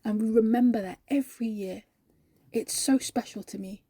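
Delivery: hushed, heartfelt testimony with long pauses; reverent sincerity. Acted. Believer telling colleague why Christmas matters, reverent. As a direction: let each phrase settle softly.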